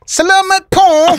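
put-on "Salamat" said high and bright in a Filipino accent. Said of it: "you nailed it".

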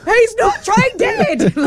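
very high, laughing imitation of a frantic child; mock panicked kid. Retelling the kid shouting at dad, high and laughing. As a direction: squeal it like the scared kid, half laughing. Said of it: "just having a full panic".

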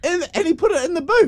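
high, astonished question; can't believe what you heard; real shock, pitched high; shocked disbelief. Real, not acted.

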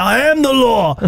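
mock-grand, high declaration; hammed-up guard laying down the law; self-important security guard. Host mimics an overzealous security guard, self-important. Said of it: "he was like".

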